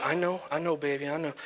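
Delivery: tender soothing. Retold or imitated. Dad forehead to forehead with crying daughter, soft soothing. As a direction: hush and console a small child.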